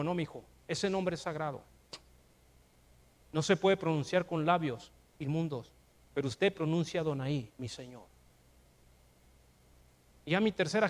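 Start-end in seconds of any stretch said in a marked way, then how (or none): 0.0-8.0 a hint of a grandfather, much softer; soft, fading reply to a grandchild; gentle grandfather. Grandfather gently answering his grandson, soft and fading.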